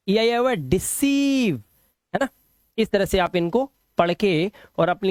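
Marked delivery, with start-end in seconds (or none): 0.7-1.6 डिसीव with a high, held ee; long vowel. Shown as a demonstration. Teacher reading an EI example, long ee in डिसीव. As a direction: stretch the ee in डिसीव. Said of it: "long vowel sound".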